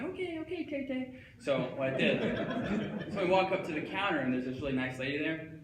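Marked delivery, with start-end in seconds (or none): 0.0-1.1 high falsetto giving in; squeaky, hurried placating. Acted. Giving in to his imagined friend at the store, high placating voice.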